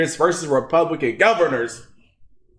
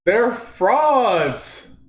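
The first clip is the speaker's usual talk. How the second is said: ordinary talk, nothing marked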